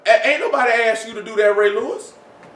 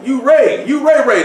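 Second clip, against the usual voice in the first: raised, teasing hype; mock adoring cheer; 6 semitones higher, slower than usual. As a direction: cheer him on like fans do. Mock adoration of Ray.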